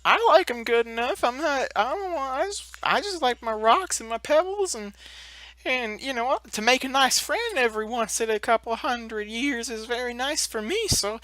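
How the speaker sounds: high, gentle giant voice; Grantho. Acted. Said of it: "Grantho is like".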